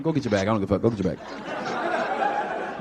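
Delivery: indifferent guard voice; uncaring guard. Retold or imitated. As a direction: brush her off, totally uninterested. Bored guard tells her go.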